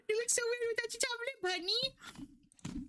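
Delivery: very high, fast, squeaky teasing voice; squeaky put-on voice. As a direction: squeak it out quickly in a silly falsetto. Repeats the remark in a squeaky voice.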